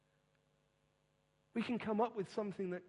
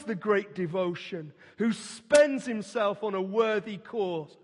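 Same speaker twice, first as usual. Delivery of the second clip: slow, swelling oratory, much louder at the peaks; impassioned reading; 7 dB louder, slower than usual. Acted. Preacher reading Roosevelt's quote to the congregation, slow and rousing. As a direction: declaim it like a rallying speech. I'll read it again.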